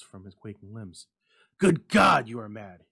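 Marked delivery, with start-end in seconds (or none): 1.6-2.9 high, loud, horrified outcry; a husband shocked by his wife's wish; appalled cry; aghast. A narrator's reading. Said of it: "he cried aghast".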